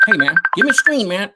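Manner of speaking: high, lightly voiced take on Roger; imitating Roger's voice. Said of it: "It was kinda like".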